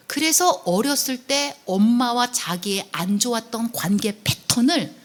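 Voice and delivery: ordinary talk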